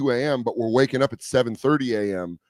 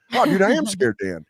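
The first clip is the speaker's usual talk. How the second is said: chuffed reply; 7 semitones higher than usual. Retold or imitated. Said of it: "And I was like".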